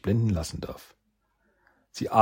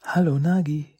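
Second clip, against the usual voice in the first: friend's voice; 8 semitones higher, 4 dB louder than usual. Acted. Shen greets him gently.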